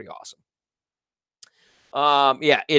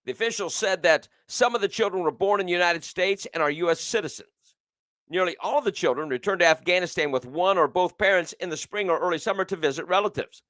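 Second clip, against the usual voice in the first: news read; 4 semitones higher, faster than usual. Acted. Reads news story.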